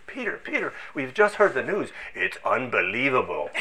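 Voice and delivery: a touch of breathless excitement; excited colleague. Retold or imitated. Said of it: "it was so excited".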